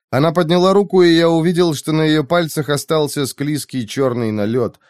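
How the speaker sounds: plain reading voice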